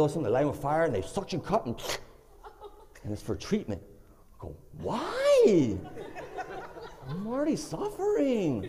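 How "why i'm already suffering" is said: high, plaintive mock wail; suffering whine. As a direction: whine it out as a comic victim. Pleads against more treatment.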